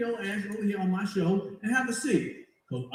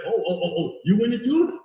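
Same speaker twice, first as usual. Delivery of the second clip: mock surprised; 3 semitones higher, 7 dB louder than usual. A snapper mocking the one who laughed, surprised. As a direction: act caught off guard, loud.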